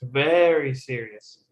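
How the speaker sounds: VERY drawn out slowly, then falling; emphatic, slow model with weight on Very; stress on VERY. Shown as a demonstration. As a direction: lean slowly on the word Very. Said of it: "Pitch on the focus words".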